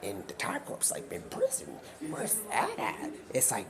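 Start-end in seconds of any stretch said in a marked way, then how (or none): 1.1-3.1 baffled question. Acted. Time Corps asking about prison, baffled. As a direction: ask it high in mock bafflement.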